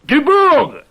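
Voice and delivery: loud high shout of a name; calling out. Acted. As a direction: call him down from the pipe. Calling out to the climber.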